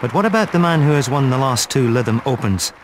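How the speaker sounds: usual speaking voice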